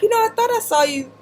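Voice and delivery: high, fast, flustered friend explaining herself; apologetic friend. Retold or imitated. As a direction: rattle it off as the embarrassed friend. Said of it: "She's like".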